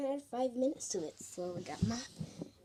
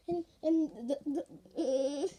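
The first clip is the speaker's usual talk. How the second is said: ordinary delivery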